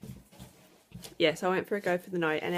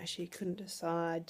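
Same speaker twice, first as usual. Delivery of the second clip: usual speaking voice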